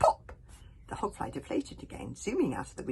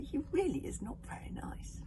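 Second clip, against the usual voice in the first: wistful; 10 semitones higher, 3 dB quieter than usual. Acted. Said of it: "said Kamikaze wistfully".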